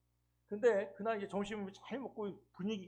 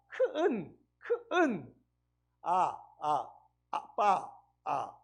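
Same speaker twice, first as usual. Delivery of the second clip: put-on coaching a toddler; 4 dB louder, much slower than usual. Shown as a demonstration. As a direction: sound it out for a small child. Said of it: "교육을 시켰어요".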